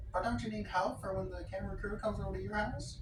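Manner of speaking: high, fast cartoon sponge voice; eager, high-pitched character impression; SpongeBob. Neighbor offering help with the camera crew, high eager SpongeBob impression.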